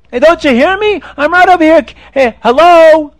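high, loud calling out; frustrated calling. Acted. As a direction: demand to be heard. King calls, ignored again.

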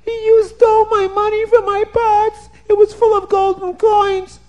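high, loud woman's voice demanding her money back; shrill it out as the cheated woman; upset woman. A narrator's reading.

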